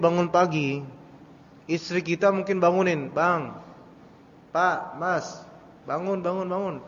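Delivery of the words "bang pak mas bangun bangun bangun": wife waking husband. Retold or imitated. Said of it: "Kita nggak sadar kan ngomong gitu".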